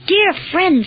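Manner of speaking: high, glad address to a friend; happily surprised welcome; joyful greeting. A narrator's reading. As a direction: greet an old friend with delight, pitch lifted. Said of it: "with every mark of joy".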